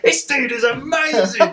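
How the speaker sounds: high, laughing gush of youthful awe; excited through laughter. Retold or imitated. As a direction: squeal it out like a starstruck kid, laughing. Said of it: "and I was like".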